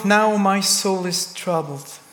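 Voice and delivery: ordinary delivery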